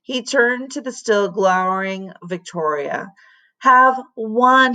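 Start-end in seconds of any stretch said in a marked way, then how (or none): 3.6-4.7 urging. A narrator's reading. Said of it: "he urged".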